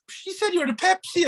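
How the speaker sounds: mother voiced high. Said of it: "she'd be like".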